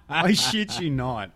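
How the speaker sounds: usual speaking voice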